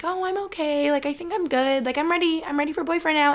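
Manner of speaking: high, sing-song imitation of her single self; mocking her past self. Vlogger imitating her single self, high mock-cheerful voice. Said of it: "I would be like".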